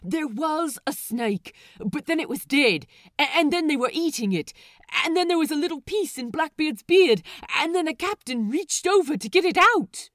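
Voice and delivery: fast, high, loud rush of gossip; eager pirate gushing the story; excited gushing. A narrator's reading. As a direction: spill it all breathlessly. Excited fan gushing about couple.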